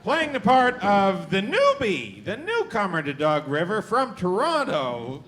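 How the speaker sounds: booming game-show announcer; announcer intro. Acted. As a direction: sell each name to the crowd. Host introduces cast members.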